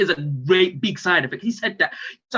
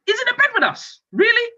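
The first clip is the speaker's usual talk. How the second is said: high-pitched incredulous complaint; exasperated disbelief; 4 dB louder than usual. Acted. Dad finds the kid in bed with the missus, high exasperation. Ranting about child in bed.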